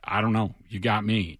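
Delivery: low, subdued, slightly halting imitation of stumped experts; stumped reply. Analyst imitating NFL people admitting they're baffled, quiet and stumped. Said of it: "NFL people were like".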